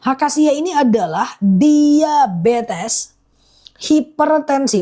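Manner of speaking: slow, deliberate repeat of each word; slowly. Real, not acted. Honey seller repeating the benefits for live viewers, slowly. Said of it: "pelan-pelan ya".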